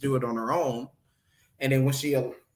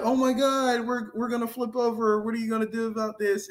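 freaking out; 7 semitones higher than usual. Retold or imitated. Retelling the disciples' fear in the storm, mock panic. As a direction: imitate panicked disciples in a raised pitch. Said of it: "the disciples are all freaking out".

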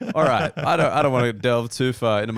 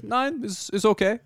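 put-on high-pitched comic Dutch-German accent; bad German accent, high and light; mock German accent; 11 semitones higher than usual. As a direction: play a German guy, pitched up. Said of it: "the Dutch accent as a German guy".

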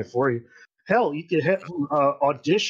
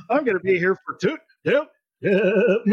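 higher, glitching robot voice stuck on a word; malfunctioning robot suit; 5 semitones higher, 3 dB louder than usual. Acted. Co-host joking about the Total Recall suit, malfunctioning. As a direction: jam up like a broken talking machine. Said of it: "malfunctions, like, the robot suit in Total Recall".

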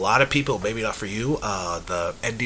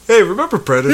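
high, loud, chirpy call; mock reminder; 11 semitones higher, 6 dB louder, faster than usual. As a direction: pester a friend with a mock-eager question. Mimics himself bringing the movie up.

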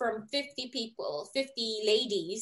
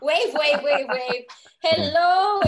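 louder, giggly chant of wave wave; playful wave wave; 5 semitones higher, 8 dB louder, much slower than usual. Real, not acted. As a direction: bounce through it like greeting fans. Guest waving playfully on entrance.

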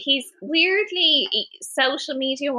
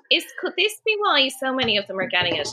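usual speaking voice

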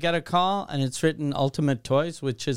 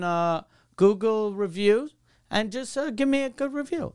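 higher-pitched, tentative salesman pitch; wheedling salesman; 6 semitones higher than usual. Retold or imitated. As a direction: imitate a salesman easing into a favor. Salesman tentatively asking for a review.

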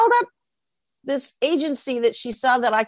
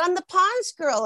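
high, perky claim; retold girlish self-introduction; rival's sweet boast; 9 semitones higher, 3 dB quieter than usual. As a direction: announce it sweetly and proudly. Acts the other girl's proud claim.

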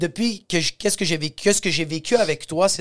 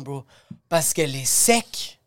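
usual speaking voice

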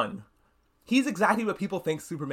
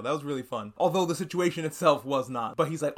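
usual speaking voice

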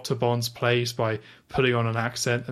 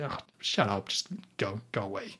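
low, muttered brush-off, trailing away; softly fed-up, fading to almost nothing; mild weary annoyance, under the breath; dismissive; 5 semitones lower, 8 dB quieter than usual. Retold or imitated. Retelling own annoyed reaction.

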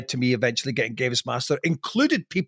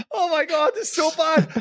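ordinary talk

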